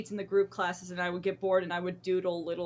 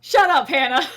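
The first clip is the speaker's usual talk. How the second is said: loud mock-outraged yell; playful shout; 4 semitones higher, 12 dB louder than usual. Real, not acted. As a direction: bark it back at a teasing friend. Playful retort at friend's teasing.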